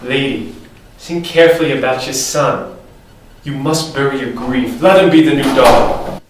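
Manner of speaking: low voice urging the widow; deep advisor voice. Acted. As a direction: speak low and heavy like a consigliere.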